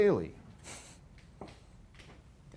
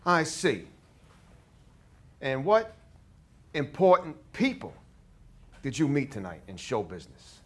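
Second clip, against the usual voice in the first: put-on loud, higher, hammy New York accent; over-the-top New York accent; 4 semitones higher, 6 dB louder than usual. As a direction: go as big as you can on New York. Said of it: "over-the-top New York we could possibly do".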